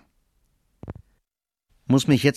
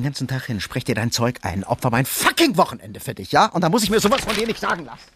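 fed up, rising louder and faster; angry outburst; 3 semitones lower, 4 dB louder than usual. Acted. Speaker complaining to Petra about a sacrificed weekend, angry outburst. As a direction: erupt in indignation at being criticised. Acted quarrel with Petra during recording.

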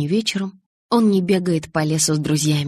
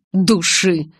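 louder derisive echo; one-word contemptuous retort; scornful snort; 5 dB louder than usual. A narrator's reading. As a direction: throw the word back in scorn. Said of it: "фыркнул он".

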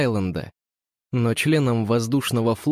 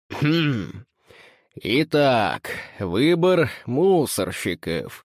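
put-on drunken pompous speech; much slower than usual. A narrator's reading. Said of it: "заводит пьяную пафосную речь".

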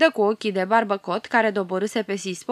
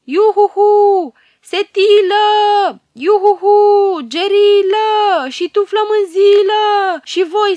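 very high, very loud hollering, hands cupped; the hero summoning his companions across the forest; calling out at full voice; 11 semitones higher, 11 dB louder than usual. Acted. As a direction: call your friends from far away. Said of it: "începe să strige cât poate de tare".

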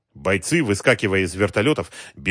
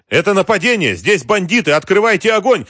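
high, loud urgent shouting; shouted alarm; 11 semitones higher, 7 dB louder than usual. A narrator's reading. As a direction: shout an order to fire under attack. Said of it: "крикнул он одному из стоявших". (громкий тревожный крик, высоко)

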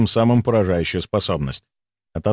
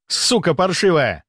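cursing; 5 semitones higher than usual. Acted. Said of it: "выругался Саймон".

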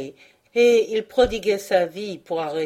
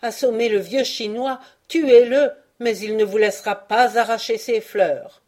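higher, loud desperate cry; 4 semitones higher, 6 dB louder than usual. A narrator's reading. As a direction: shout it in defiance of death. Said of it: "criait-il".

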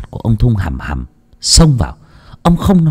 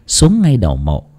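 ordinary delivery

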